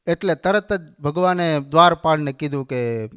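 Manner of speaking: ordinary talk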